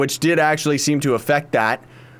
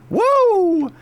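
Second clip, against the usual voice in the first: sneeze. Real, not acted. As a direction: let a real sneeze burst out. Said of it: "I just sneezed".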